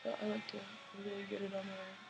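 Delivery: talking while doing mascara; applying mascara. Real, not acted. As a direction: speak mid-mascara, mouth stretched. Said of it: "Sorry, I'm doing my mascara first".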